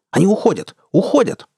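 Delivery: high, urgent exclamation; alarmed cry. A narrator's reading. Gunner sees the pirates getting away, alarmed. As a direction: cry out that the quarry is escaping. Jayana cries out as pirates escape.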